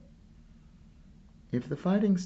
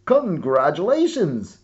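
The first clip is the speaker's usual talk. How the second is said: cheerful announcement; 8 semitones higher, 11 dB louder than usual. Acted. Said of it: "said the note".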